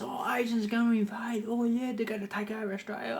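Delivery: high mocking whine of a scaremonger; mocking Pauline Hanson. Mocking a politician's panic about Asians, high and whiny. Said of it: "she was having a go at Asians".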